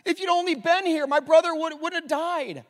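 high, loud pleading cry; impassioned plea. Acted. Preacher voicing Martha crying out to Jesus, loud and high. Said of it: "Martha, his sister, says".

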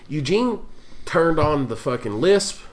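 ordinary talk, nothing marked

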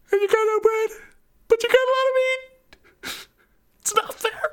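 high, rising whiny complaint; whiny complaint to the shelf. Retold or imitated. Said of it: "and said".

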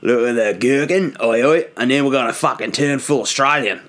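put-on tour guide's London accent drifting into Australian; hammed-up, wandering London-Australian tour patter; botched London accent turning Australian. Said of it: "what happened to the accent there".

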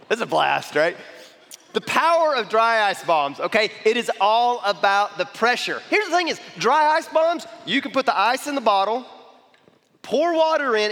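loud, fired-up pitch with a shout on bombs; hyped science-demo voice; excited explanation. Real, not acted. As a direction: sell the danger of the bombs with big energy. Excited demo of dry ice bombs.

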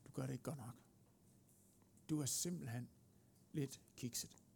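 soft, low voice of self-blame; quiet inner accusation. Acted. Accusations sounding inside our spirit, quiet and low. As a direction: drop it low, like a thought creeping in. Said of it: "hvor der kan lyde anklager".

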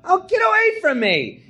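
high, loud panicked shriek; hammed-up terrified yelling; horrified scream. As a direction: scream in shock at being tricked. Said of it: "all of a sudden you hear me go".

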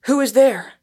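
loud, high call into the dark; startled shout at a stranger. A narrator's reading. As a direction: shout up at the figure in the tree. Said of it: "shouted Arthur".

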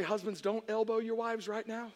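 usual speaking voice

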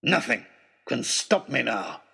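low, scornful villain's snarl; villain snarling at teacher and girl. Acted. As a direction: spit each word at them. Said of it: "he snarled".

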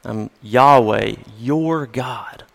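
slow, low and soft; voice of God, tender. Shown as a demonstration. God introducing himself to his people: slow, soft and tender. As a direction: let it land gently as a personal name. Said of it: "But when God says this".

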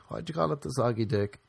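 ordinary delivery

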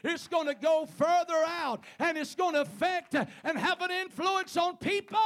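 loud, high preacher's cry rolling on; preaching at full force. Acted. Preacher calling to his congregation, loud and high. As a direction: shout it out to the whole church.

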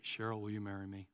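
very low, soft, quick mumble; passionless proposal. Acted. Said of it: "I didn't say".

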